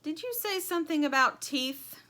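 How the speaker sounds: a hint of a dim old sheep. A narrator's reading. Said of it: "she asked dimly".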